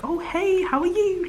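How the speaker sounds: high, chirpy acted greeting; mock small talk. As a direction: put on a high-pitched polite hello. Acts out small talk on Zoom.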